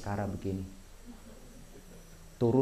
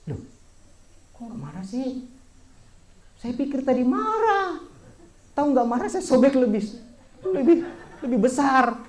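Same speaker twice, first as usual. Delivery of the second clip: much higher, louder, squeaky mock protest; comic mock whine; 10 dB louder than usual. Novice jokes he should have torn it more, high mock whine. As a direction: play the cheeky novice pretending to be let down.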